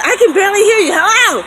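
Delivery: imitating the friend straining to hear; friend on the phone. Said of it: "And he said".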